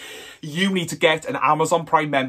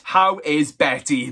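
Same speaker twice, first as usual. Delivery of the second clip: ordinary talk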